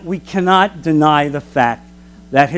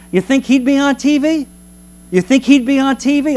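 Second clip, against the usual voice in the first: high, teasing question about TV; mock incredulous; 7 semitones higher than usual. Shown as a demonstration. Preacher teasing his congregation, incredulous. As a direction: ask it like it's absurd. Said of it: "mocking rhetorical question repeated".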